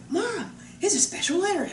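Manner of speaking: put-on excited little boy. Young Thomas hands Mom the letter, excited. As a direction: call out high like a schoolboy to his mom.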